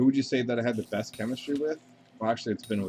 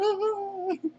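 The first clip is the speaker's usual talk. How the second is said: squeaky falsetto, much higher, backing off; mock high-pitched plea; 12 semitones higher, 4 dB louder, slower than usual. Calming down an aggressive co-performer, in a squeaky falsetto. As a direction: play it like a nervous squeal at an angry girl. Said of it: "and you're like".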